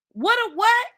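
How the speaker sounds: high, baffled what; incredulous. Real, not acted. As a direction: squeak out disbelief. Disbelief at the clip.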